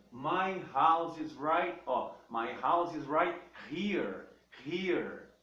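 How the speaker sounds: "house" and "here" said slowly, with a clear breathy H; slow down and breathe out the H in "here"; slow H demo. Shown as a demonstration. Teacher drills students slowly on "my house is right here". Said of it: "A palavra here".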